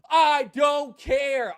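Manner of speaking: shouting I don't care; exasperated shout. Real, not acted. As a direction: bellow it, totally fed up. Ranting climax.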